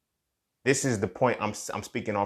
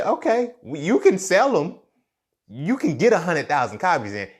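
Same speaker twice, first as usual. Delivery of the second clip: higher, louder voice of the universe granting a wish; the universe answers you, higher and louder; voicing the universe; 7 semitones higher, 6 dB louder than usual. The universe answering the speaker's wish, voiced higher and louder.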